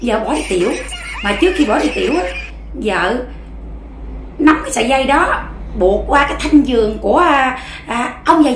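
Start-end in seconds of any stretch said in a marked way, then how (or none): none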